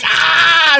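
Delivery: loud high "Ah!"; playful roar. Retold or imitated. Answering his daughter with a loud high roar.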